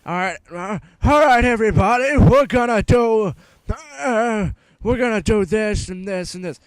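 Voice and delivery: high, loud mock rant, building to a shout; hammed-up furious teacher taking over the class; mad teacher. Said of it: "she gets so fucking mad".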